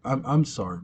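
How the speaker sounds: softly mumbled mock condolence; understated, barely reacting; unfazed apology. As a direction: apologize without a trace of shock. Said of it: "she's not even, like, batting an eye".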